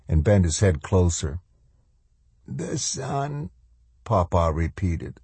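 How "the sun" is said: put-on faint, high voice of a failing old man; muttered. A narrator's reading. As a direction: murmur it very softly, barely getting it out. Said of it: "the old man muttered something".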